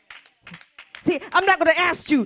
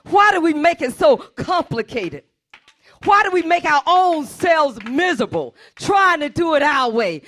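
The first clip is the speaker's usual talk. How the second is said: loud, pounding pulpit preaching; a pastor preaching at full force; 2 semitones higher, 5 dB louder than usual. Acted. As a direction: drive every "you must forgive" home to the congregation. Preaching to the church.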